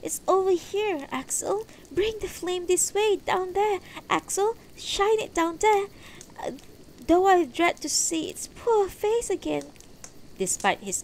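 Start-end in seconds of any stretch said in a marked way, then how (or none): 0.0-9.6 high, raised woman's voice; urgent, distressed wife. Acted. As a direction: urge him over in a high, loud voice. Distressed wife urges husband to look.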